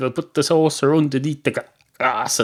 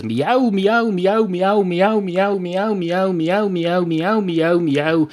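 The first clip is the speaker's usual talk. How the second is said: higher-pitched singsong string of cat meows; repeated meowing; 5 semitones higher, much slower than usual. Acted. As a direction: meow over and over in a raised voice.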